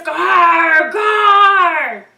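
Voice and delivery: high, loud roaring monster show; monster growl. Acted. Ben acting out Bigfoot for the crowd, loud roar. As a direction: ham up a scary monster roar. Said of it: "he sounded like this".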